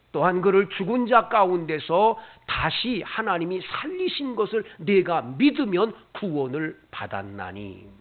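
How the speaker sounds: normal talking voice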